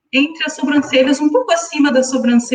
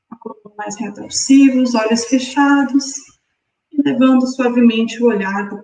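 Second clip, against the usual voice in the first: guided meditation. Acted. Guides breathing exercise.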